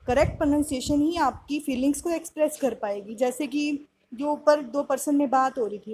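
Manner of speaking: ordinary talk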